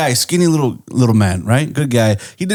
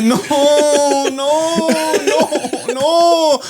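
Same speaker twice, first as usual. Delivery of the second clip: very high mock wail; hammed-up high scream, no added volume; imitated screaming; 12 semitones higher, much slower than usual. Answering "are you good?" as his panicking friend, mock screaming. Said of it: "he starts, like, screaming".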